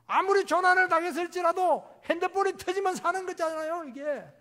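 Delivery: high, loud, sustained exhortation; full-force preaching. Real, not acted. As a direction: cry it out fervently to the congregation.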